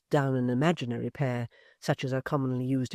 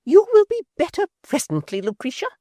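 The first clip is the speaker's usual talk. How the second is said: high, loud scornful echo; sneering high repeat; exceeding scorn; 12 dB louder than usual. A narrator's reading. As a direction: throw her words back with contempt. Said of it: "with exceeding scorn".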